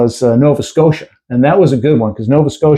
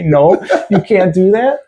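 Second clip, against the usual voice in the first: parents saying no; 6 semitones higher than usual. Retold or imitated. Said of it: "we don't wanna hear 'em say".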